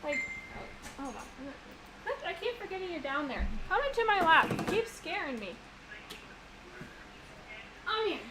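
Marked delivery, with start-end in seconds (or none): 2.0-8.2 high, cooing pet voice. Real, not acted. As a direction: coo sweetly at the little animal. Talking to pet on lap.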